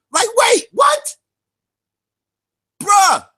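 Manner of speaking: can't believe what he heard; stunned disbelief. Real, not acted. Vlogger reacting to Si Neter's contradiction in disbelief. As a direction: pitch jumps way up on what.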